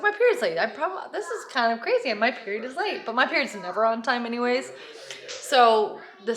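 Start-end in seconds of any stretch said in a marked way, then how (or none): 0.0-3.1 retold excitement. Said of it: "but I was like".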